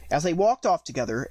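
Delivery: ordinary delivery, nothing marked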